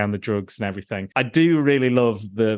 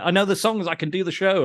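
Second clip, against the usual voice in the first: higher, eager pleading quote; mock eager character; 7 semitones higher than usual. Host quoting the character at the club, mock eager. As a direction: insist you can do the show. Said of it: "he's like".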